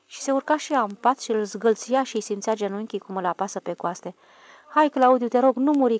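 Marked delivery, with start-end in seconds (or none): 4.7-6.0 raised and pleading, higher; louder tearful plea. Acted. Little girl begs her brother, louder pleading. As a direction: beg him loudly not to die.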